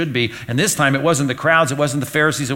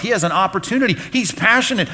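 ordinary talk, nothing marked

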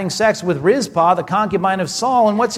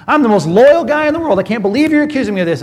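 indignant outburst; 6 semitones higher, 7 dB louder, faster than usual. Retold or imitated. Abner indignant at Ishbosheth.

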